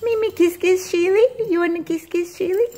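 high, loud cooing. Real, not acted. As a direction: coax a kiss sweetly. Asking the pets for kisses.

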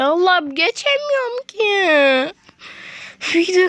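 high, strained whine of defeat; high frustrated gamer whine. Real, not acted. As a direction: wail it like you just can't win. Fails the level again.

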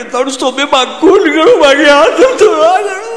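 high, slow stuttering voice, well above his own; mock stammerer drawling slowly and high; stammerer's reply. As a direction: play the stammering man, drawn out. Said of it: "મને કે".

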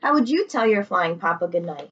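usual speaking voice

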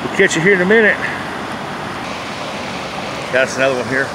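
ordinary talk, nothing marked

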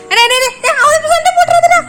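very high, loud panicky pleading; alarmed pleading. Real, not acted. Owner begging her pets not to fight, high panicked plea. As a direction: squeal it out in alarm.

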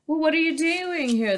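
high pert challenge from the cub; unkindly. A narrator's reading. Lion cub rudely quizzing the lost dragon. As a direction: needle the stranger. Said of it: "the cub asked, very unkindly".

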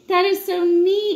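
delighted, loud and high; genuinely wowed by the picture; excited. Real, not acted. Parent showing kids a bug photo, excited.

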